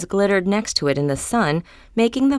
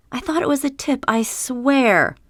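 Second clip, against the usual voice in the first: a touch of pleading, higher voice; lightly voiced protest to her manager; insisting innocence; 8 semitones higher than usual. A narrator's reading. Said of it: "she'd insisted to her manager".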